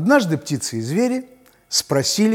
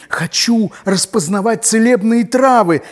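snake voice; 8 semitones higher, 6 dB louder than usual. Acted. Snake asks about herbs.

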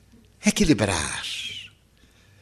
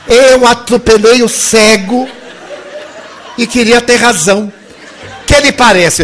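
much louder, higher, laughing; 7 semitones higher, 8 dB louder than usual. Real, not acted. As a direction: push through the laughter. Laughing punchline.

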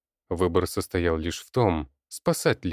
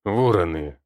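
husky voice; 5 dB louder than usual. Acted. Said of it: "хрипловатым голосом".

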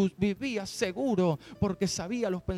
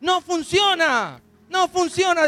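higher, louder, mildly frustrated devil; 9 semitones higher, 6 dB louder, slower than usual. Retold or imitated. Preacher voices the devil failing against the church, loud mock frustration. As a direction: ham up a baffled devil. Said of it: "dice el diablo".

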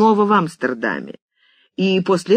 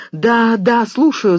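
higher, eager rush of yeses; flustered babble; 6 semitones higher, 4 dB louder, slower than usual. A narrator's reading. George grabs the receiver after leaping from bed, flustered. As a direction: answer in an excited fluster. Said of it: "забормотал он".

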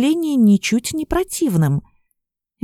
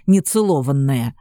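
нецелованная lower and pointedly stressed; stressed word; 8 semitones lower than usual. A narrator's reading. Горыныч подчёркивает слово нецелованная, low and pointed. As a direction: lean hard on нецелованная, low and meaningful. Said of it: "Последнее слово он особенно выделил".

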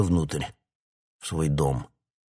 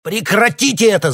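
much higher, much louder shout; officer shouting to break up the fight; 10 dB louder than usual. A narrator's reading. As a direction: bark the order to stop the fight. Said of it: "закричал Грей".

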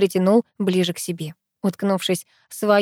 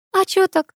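high-pitched teasing echo; mocking mimicry; 11 semitones higher than usual. A narrator's reading. Girl mimics the pushy guy's question, mocking and high. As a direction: mimic him, pitched well up. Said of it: "передразнила я".

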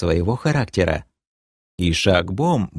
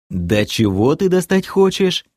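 exasperated; 8 semitones higher than usual. Acted. Exasperated question.

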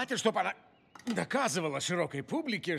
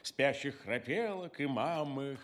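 put-on reading to a toddler; 5 semitones lower than usual. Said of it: "он читает малышу в спальне".

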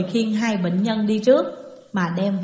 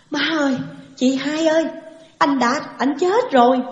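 higher-pitched, lightly acted wail of bad news; acted distressed calling, raised pitch; tearful cry; 5 semitones higher than usual. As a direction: call out in mild mock grief. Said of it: "vừa khóc vừa kêu nói".

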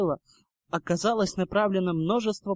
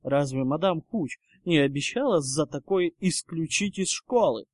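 ordinary delivery

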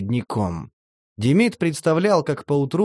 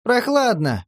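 much higher, curt; curt retort; 9 semitones higher, slower than usual. A narrator's reading. Vena cuts Demid off, curt and much higher. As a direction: cut him off with a quip. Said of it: "отрезал Вена".

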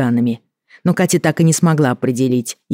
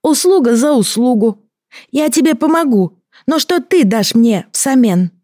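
gurgling alien voice; 10 semitones higher than usual. Acted. Creature bargains with Katya.

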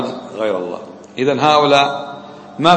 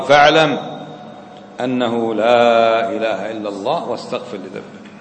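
Quran recitation; 4 semitones lower, slower than usual. Acted. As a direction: slip briefly into measured recitation. Teacher quotes a Quran verse.

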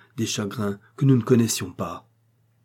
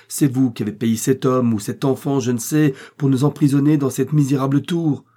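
loud, higher-pitched tirade; brusque vehemence; 4 semitones higher, 6 dB louder than usual. A narrator's reading. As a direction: accuse him with mounting heat. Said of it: "avec une brusque véhémence".

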